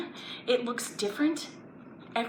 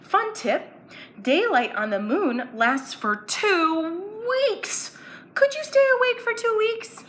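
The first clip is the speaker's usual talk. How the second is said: higher, bright teasing question; playful fun fact; 11 semitones higher, 6 dB louder than usual. Acted. Teacher shares a fun fact, higher and playful. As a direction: tease them with it.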